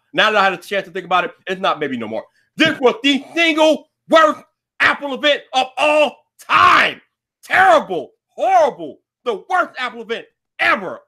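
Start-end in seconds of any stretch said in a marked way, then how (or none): 2.6-11.0 exasperated outburst. Real, not acted. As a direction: cry out the verdict in disgust. Outraged verdict on Apple event.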